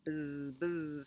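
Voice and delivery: soft buzz with long z's; buzzing bee. Acted. Bee answers Cow in a story, soft buzzing. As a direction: draw out the zzz like a bee. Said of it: "said the bee".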